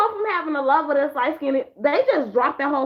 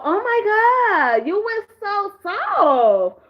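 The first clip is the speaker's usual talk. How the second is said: higher, very slow, swooning; dreamy lovestruck sigh of a line; mock melting; 6 semitones higher, much slower than usual. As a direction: melt over him as you say it. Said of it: "did you look at K B and go".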